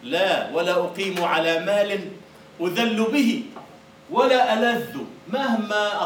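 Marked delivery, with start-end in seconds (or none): 0.5-5.0 slow, loud proud declaiming; poem recital. Acted. Teacher declaiming Mutanabbi's proud verse, loud and slow. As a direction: proclaim the verse with defiance.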